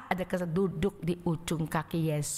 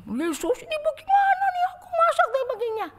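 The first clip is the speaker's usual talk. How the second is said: much higher, put-on whining plea; whiny complaint; 6 dB louder than usual. Martha complaining to Jesus, high whining voice. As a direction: whine to Jesus like a put-upon sister. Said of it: "Waduh, udah".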